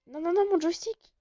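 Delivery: high, loud "non non non"; frustrated shout. Real, not acted. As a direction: yell at the broken controls. Joystick fails mid-fight.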